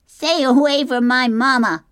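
high, loud little gosling cry; small gosling shouting. A narrator's reading. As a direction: shout it like a brave little gosling protecting his mama. Gosling defends robot mother.

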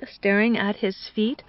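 usual speaking voice

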